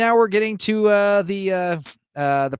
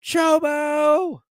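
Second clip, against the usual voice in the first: high calls of Chobo; mock scream of a name; 3 dB louder, much slower than usual. Little girl screaming for Chobo at the well, high calls. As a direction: call him back like a little girl. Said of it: "starts screaming".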